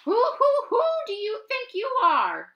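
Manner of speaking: very high, loud hooting screech; screeching owl. Acted. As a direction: hoot it out angrily like an owl. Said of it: "screeched Owl".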